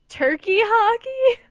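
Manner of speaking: slow high mocking repeat of Turkey hockey; teasing echo. Real, not acted. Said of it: "what the hell did I say turkey for".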